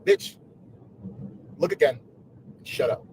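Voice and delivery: curt retort. Retold or imitated. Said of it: "I said".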